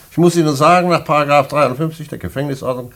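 stern official voice. Acted. Director lectures condemned prisoner.

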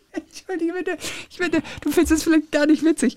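high giggly excuse; laughing apology. Real, not acted. Apologising to co-host, high laughing.

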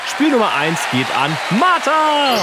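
loud, high, drawn-out winner call; excited announcement. Real, not acted. (feierlich ausgerufen)

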